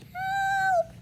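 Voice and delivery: very high, drawn-out little cry; tiny squeak. Acted. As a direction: imitate the nut's squeaky plea. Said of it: "squeaked the nut".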